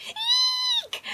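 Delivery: high, piercing, loud shriek of fright; lion screaming in panic at the crocodile; panicked scream. Acted. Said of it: "screamed Leo as he retreated with haste in panic".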